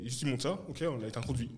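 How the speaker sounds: ordinary talk, nothing marked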